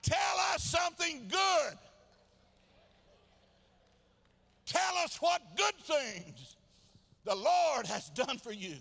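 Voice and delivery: loud, high, drawn-out exhortation; full-force preaching. Real, not acted. Preacher asking the congregation for good testimony, full-force preaching. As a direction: call the people to praise, slow and loud.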